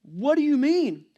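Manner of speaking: high, slow disbelief; Mary baffled by the angel; incredulous Mary. Retold or imitated. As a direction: plead to understand as Mary. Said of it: "this is a very big deal".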